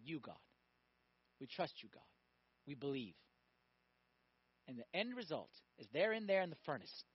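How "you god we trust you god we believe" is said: very soft, low, chastened replies; hushed, sheepish admission of faith; meek submission. Acted. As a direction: answer small and humbled, with pauses. The chastened men answer God.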